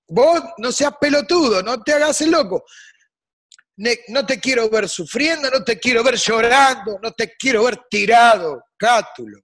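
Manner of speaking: high, loud urging shout; impassioned plea. Real, not acted. Guest shouts advice at the poet, loud and high. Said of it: "urging Catullus directly".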